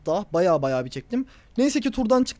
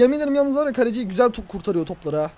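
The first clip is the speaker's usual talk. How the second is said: normal talking voice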